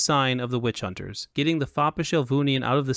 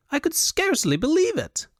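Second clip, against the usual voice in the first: high-pitched, excitable foppish voice; high and animated Elvunian dandy; high Elvunian fop. A narrator's reading. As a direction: play a foolish fop in a high, affected register. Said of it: "he said in a high Elvunian accent".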